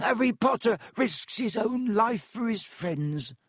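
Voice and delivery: high, slow elf moan; squeaky, rapturous elf lament; miserable ecstasy. A narrator's reading. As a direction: moan in tearful adoration as a little elf. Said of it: "moaned Dobby in a kind of miserable ecstasy".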